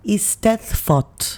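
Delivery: Eisteddfod said slowly, ees-TETH-vod; careful slow pronunciation. Shown as a demonstration. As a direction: sound out Eisteddfod syllable by syllable.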